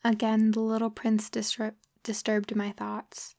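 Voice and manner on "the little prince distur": plain reading voice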